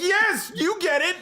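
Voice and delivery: high, exultant relief; hammed-up delighted approval; mock triumph. Retold man finally feels understood.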